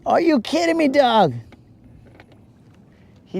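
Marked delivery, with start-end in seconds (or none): 0.0-1.3 much higher, disbelieving outcry; can't believe what he just read; surprised. Real, not acted. Reacting to a chat message.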